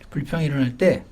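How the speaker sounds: ordinary talk, nothing marked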